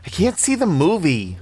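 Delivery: put-on high-pitched kid's protest; complaining child. A narrator's reading. Child shoves Annie from the TV, high complaining voice. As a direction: protest like a child whose view is blocked.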